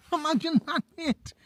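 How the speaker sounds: spooked thrill at the thought of a dwarf; high-pitched panicky glee; squeaky excitement. Real, not acted. Picturing a dwarf appearing.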